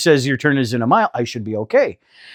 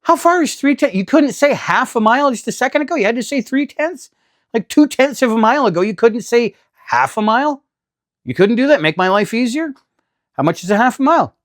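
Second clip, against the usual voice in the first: much higher, indignant disbelief; high-pitched exasperated rant; 9 semitones higher than usual. Retold or imitated. Driver complaining to the GPS, high and exasperated. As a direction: whine at the GPS for being difficult.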